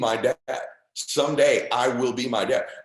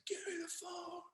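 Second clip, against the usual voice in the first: imitating a breathless dying father straining to be heard; strained sick father demand; 20 dB quieter than usual. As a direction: push it out with no breath left. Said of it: "My dad goes".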